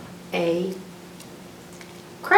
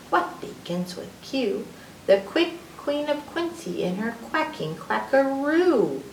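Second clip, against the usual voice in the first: ordinary talk, nothing marked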